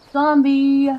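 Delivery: loud startled yell; alarmed shout. Real, not acted. Zombie appears, player yells.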